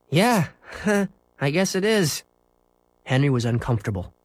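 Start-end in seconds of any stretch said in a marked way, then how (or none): 0.1-2.2 sheepish. Acted. Henry uneasy.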